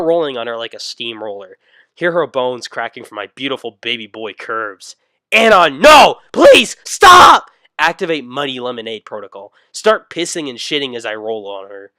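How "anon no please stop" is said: far higher, far louder pleading shriek; mom's terrified scream. Acted. Mom screams at son to stop rolling on her. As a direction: shriek like a crushed mother begging.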